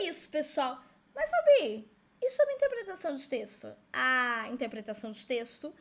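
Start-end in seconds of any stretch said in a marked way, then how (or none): 1.1-3.7 voz aguda e alta de aluno interrompendo; aluno aflito encenado. Acted. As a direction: imite um aluno chamando a professora, bem agudo. Aluno imaginado interrompe com pergunta. (aluno encenado, chamando em tom agudo e alto)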